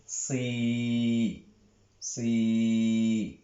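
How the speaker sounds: drawn-out "si" with the emphatic Arabic s; teacher modelling "si"; the syllable si. Shown as a demonstration. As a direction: say "si, si" long and steady, demonstrating the heavy sad. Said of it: "On dit".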